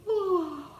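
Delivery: high drawn-out yawn. Shown as a demonstration. Yawning to show how it sounds. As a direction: let a big yawn out. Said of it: "I go".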